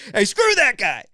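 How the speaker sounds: high, loud mock outrage; joking outburst. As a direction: yell it in playful disgust. Mock outrage at chill Swede.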